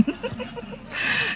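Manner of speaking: airy silent laugh; breathless laugh. Real, not acted. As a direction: laugh without voice at the joke. Preacher laughs at story.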